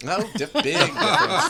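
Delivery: laughing. Real, not acted. Joking about finally paying him.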